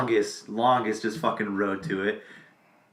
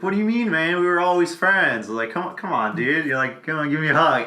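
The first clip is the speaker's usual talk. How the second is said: mock buddy voice; 6 semitones higher, 4 dB louder than usual. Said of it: "He's like".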